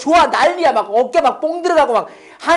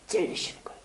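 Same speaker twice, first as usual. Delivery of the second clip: breath-only solemn preaching; pained whisper; 15 dB quieter than usual. Real, not acted. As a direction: whisper it for weight. Hushed point about God's pain. (속삭이듯 숙연하게)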